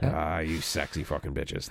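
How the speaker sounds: slow, low appreciative drawl; mock leering. Passenger to the crew who pranked him, mock leering. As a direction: turn the curse into a slow, joking compliment.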